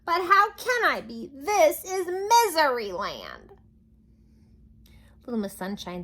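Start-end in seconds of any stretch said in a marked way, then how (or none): 0.0-3.5 despairing wail. Acted. King wailing in despair.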